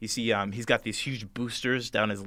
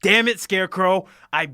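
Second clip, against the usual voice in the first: loud, higher-pitched furious outburst; angry Tin Man; 8 semitones higher, 8 dB louder than usual. Acted. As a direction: lay into Scarecrow at full volume. Tin Man slams table, berates Scarecrow.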